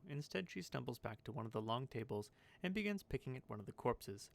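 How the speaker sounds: ordinary talk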